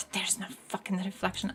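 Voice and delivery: exasperated whisper. Real, not acted. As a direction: breathe it out in a fed-up whisper. Exasperated aside about the fake clip.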